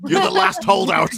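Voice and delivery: high, slow, loud mock holler; mock shout. Bodyguards confronting the last holdout, mock shout.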